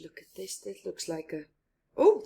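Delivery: usual speaking voice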